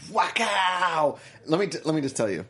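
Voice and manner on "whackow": drawn-out mock fight shout; slow-motion kick cry. Narrating a movie kick, slow-motion shout. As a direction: stretch the yell as if slowed down. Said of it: "Slow motion".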